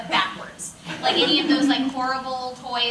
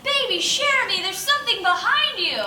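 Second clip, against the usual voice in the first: much higher and louder warning; mock alarmed director; 11 semitones higher, 5 dB louder than usual. Director warns him that something stands behind him, high mock alarm. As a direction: call out a teasing alert.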